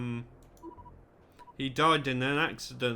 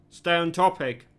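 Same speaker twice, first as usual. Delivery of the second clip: louder stern order; 3 semitones higher, 6 dB louder than usual. Acted. Chief of Security snaps at Lewis to stay on topic, louder. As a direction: repeat the command with more force, near barking.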